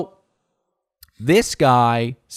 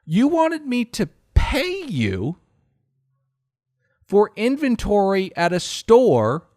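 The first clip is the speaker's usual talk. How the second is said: incredulous; 5 semitones higher than usual. Retold or imitated. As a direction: lay out the logic point by point, exasperated. Confronting the clerk.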